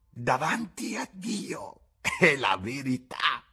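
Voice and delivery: slow, high, loud oath; cried out. A narrator's reading. Bar woman swearing to God, crying out slowly. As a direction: cry out the oath to heaven slowly. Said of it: "gridò".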